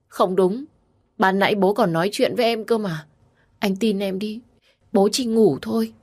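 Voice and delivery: high, pleading insistence; distressed denial. A narrator's reading. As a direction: beg him to believe you. Wife refuses to accept the death. (giọng van nài, không chịu tin)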